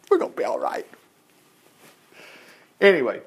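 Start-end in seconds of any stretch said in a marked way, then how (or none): 0.1-0.9 tiny, high, soft voice; naively confident David. Acted. David reassuring his reluctant men, in comically small reassurance. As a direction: squeak out a mock reassurance. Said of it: "David's like".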